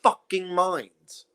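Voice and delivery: very slow, stretched words; slow emphatic. Real, not acted. Complaining about people, stretched slowly. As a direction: drag it out for weight. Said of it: "they lose their minds nowadays".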